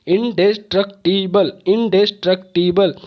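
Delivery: इंडेस्ट्रक्टिबल split into five separate beats; syllable by syllable. Shown as a demonstration. Teacher showing five syllables, इंडेस्ट्रक्टिबल broken up. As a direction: break इंडेस्ट्रक्टिबल apart at every syllable. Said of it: "तोड़ तोड़ के आवाज़".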